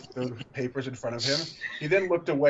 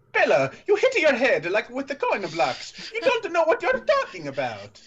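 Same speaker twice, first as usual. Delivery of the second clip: much higher, louder cutting voice; 12 semitones higher, 5 dB louder than usual. Acted. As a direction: cut her down with a sneer. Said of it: "His tone was cutting".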